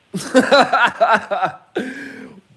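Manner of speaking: high, loud burst of laughter. Real, not acted. Vlogger cracking up at his own story, loud laughing. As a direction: burst out cackling mid-story.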